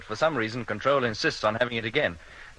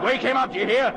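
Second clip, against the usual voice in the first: louder and much higher, exasperated; angry shouted order; 12 semitones higher, 6 dB louder than usual. Acted. Captain shouting at defiant crewman, angry. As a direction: shout it as a final demand.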